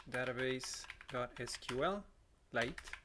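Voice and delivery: ordinary talk, nothing marked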